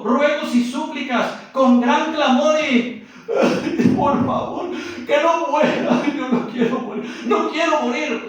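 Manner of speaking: loud high wailing plea; clamor and beg not to die; crying out with great clamor. Acted. Jesus cries to the Father, loud high wailing plea. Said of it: "Dice el versículo".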